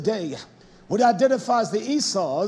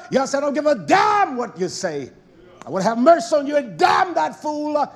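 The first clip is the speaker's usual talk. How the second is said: preaching shout; 4 semitones higher than usual. Real, not acted. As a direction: thunder it from the pulpit.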